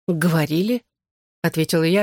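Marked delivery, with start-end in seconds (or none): none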